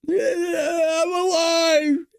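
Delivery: high, drawn-out croaking cry; hammed-up undead shout, stretched very long; risen from crypt. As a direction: screech like a corpse climbing out of its crypt. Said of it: "crawled out of a crypt".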